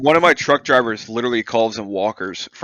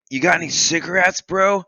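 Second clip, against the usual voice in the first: higher, drawn-up voice ending on a rising bro; imitating homeless guy; 8 semitones higher than usual. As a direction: cadge a smoke like a drifter. Voicing homeless guy asking favors.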